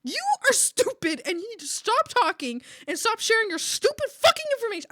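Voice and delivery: high, loud furious rant, hammed-up; so mad. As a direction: re-enact blowing up at the roommate. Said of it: "I was so mad".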